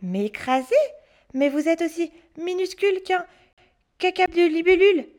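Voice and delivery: mock the witch cheekily; boy taunting the tiny witch; cheeky boy.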